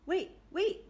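excited shout. A narrator's reading. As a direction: shout out to stop everyone. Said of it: "shouted Antonia".

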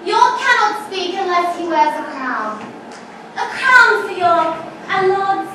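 proud, scornful queen mocking a captured enemy; Queen Margaret taunting York. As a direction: declaim it like a vengeful queen gloating over her prisoner. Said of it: "I'm actually playing the role of Queen Margaret".

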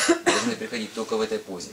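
normal talking voice

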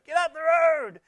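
loud road-rage shout copied from Goldie; imitated road shout. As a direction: shout like Goldie behind the wheel. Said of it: "She's heard that from Goldie".

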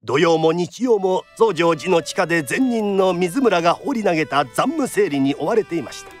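ordinary talk, nothing marked

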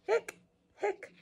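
high hiccup yelps; cartoon hiccup sounds; squeaky hiccups. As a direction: pop out high little hiccups. Skeleton's hiccups voiced in story.

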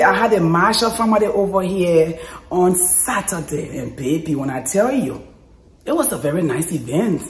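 usual speaking voice

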